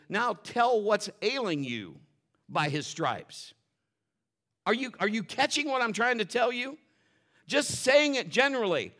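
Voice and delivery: ordinary talk, nothing marked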